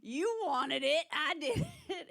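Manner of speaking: loud, high, slow Southern boast; bragging twangy doctor. Retold or imitated. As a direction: brag like a drawling doctor who cured cancer. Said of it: "I could be like".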